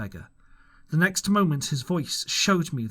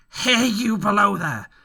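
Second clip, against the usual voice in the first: cry; 8 semitones higher, 7 dB louder than usual. A narrator's reading. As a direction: cry out to the man below. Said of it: "cried the blind man again".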